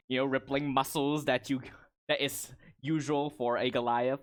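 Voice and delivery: usual speaking voice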